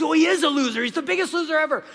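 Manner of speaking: high, loud, quick protest; acted schoolyard bully doubling down; insistent bratty kid. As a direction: blurt it out like a defiant child. Bully defends insult to teacher.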